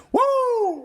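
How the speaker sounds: high, loud burst of woo; shouted woo. Retold or imitated. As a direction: let out a big excited whoop. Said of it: "you like".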